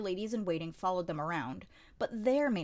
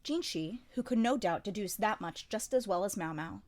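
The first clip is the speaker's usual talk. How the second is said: plain reading voice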